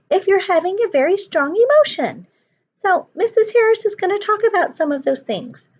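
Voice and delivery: high cheerful puppet voice; chirpy little puppet greeting the kids. Acted. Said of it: "She's gonna talk to you guys".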